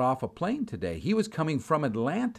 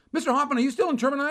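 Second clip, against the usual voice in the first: shocked clerk; 11 semitones higher, 4 dB louder, much faster than usual. Retold or imitated.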